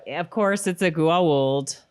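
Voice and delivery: ordinary delivery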